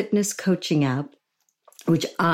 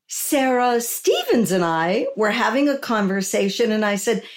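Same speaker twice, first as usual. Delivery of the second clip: ordinary talk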